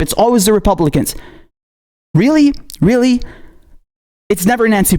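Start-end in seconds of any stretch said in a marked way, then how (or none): 2.1-3.2 incredulous. Real, not acted. Sarcastic outrage.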